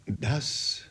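low and soft, with a deep sigh; barely able to say it; quiet voice. A narrator's reading. Uncle Toby breaking news of a death, quietly. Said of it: "mit leiser Stimme".

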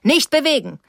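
high, forceful shouted order; thundering command. A narrator's reading. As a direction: order them to freeze in a booming voice. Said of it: "sagte er mit donnernder Stimme".

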